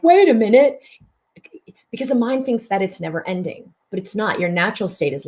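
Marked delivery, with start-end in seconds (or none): 0.0-0.8 high, loud, startled interjection; mind's alarmed objection. Retold or imitated. Healer acting out the mind objecting, high and loud. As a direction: jump in like a panicking mind. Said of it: "But the mind is like".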